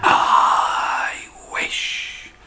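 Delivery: whispered start of a spell; whispered wish. Acted. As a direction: breathe the words in secret. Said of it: "She pointed to herself and went".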